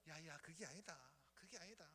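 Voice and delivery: calm the complaining prophet gently; God answering Habakkuk, low and soft. Acted. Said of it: "하나님께서".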